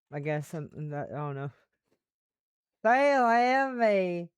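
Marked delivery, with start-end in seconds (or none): 2.8-4.3 high, loud, drawn-out Southern drawl; milk the French phrase in a Southern accent. Acted. Repeating a funny line for fun, in a Southern accent. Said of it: "in a southern accent".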